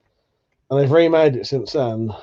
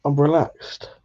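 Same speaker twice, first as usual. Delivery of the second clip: ordinary talk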